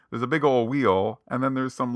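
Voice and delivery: ordinary talk, nothing marked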